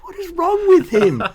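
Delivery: very high-pitched, exasperated disbelief; mock incredulous listeners. Imagined listeners judging his American accent, high mock incredulity. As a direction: squeal it like a baffled, scandalised audience. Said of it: "everyone will be like".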